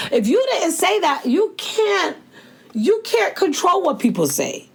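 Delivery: high, emphatic declaration; impassioned preaching. Acted. Urging listeners to own their reactions, preaching hard. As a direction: preach it, climbing high and insistent.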